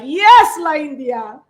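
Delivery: high, loud opening, softening into drawn-out agreement; acted fan cheering. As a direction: egg the singer on like delighted fans. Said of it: "And all of us are like".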